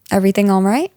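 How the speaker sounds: ordinary talk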